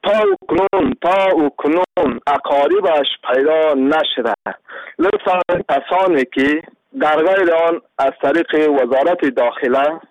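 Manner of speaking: normal talking voice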